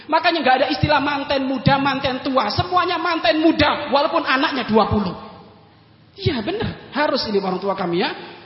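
usual speaking voice

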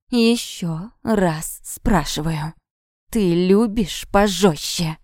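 very slow, drawn-out threat; menacing repeat. A narrator's reading. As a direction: press each word slowly on the victim. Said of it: "повторил он".

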